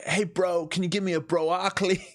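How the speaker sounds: high dopey bro at the bar; Chris D'Elia bit. Acted. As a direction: ask for it like a clueless bro. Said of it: "I've been watching too much Chris D'Elia".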